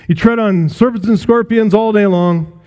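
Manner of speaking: ordinary talk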